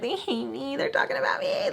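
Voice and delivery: small, high panicky squeal; mock freak-out. Teen overhearing mumbling downstairs, high mock panic. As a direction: squeak it softly, panicking in your head. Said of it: "freaking out".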